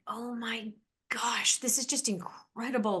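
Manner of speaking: breathless hushed whisper of wonder; awed whisper. Retold or imitated. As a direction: whisper it as if struck by awe. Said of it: "I just remember being like".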